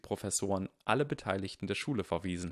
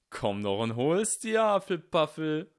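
shouted taunt; 9 semitones higher, 6 dB louder than usual. A narrator's reading. Goyle taunts Ernie from the broom, shouting. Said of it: "schrie Mister Goyle".

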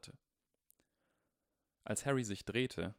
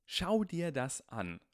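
outraged; 8 semitones higher, 5 dB louder, slower than usual. Acted. Harry outraged at the bill.

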